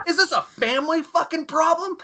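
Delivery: very high mock outrage; incredulous, high-pitched retold outburst; acted indignant officer. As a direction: imitate an officer demanding an answer. Angry officer interrogates recruits.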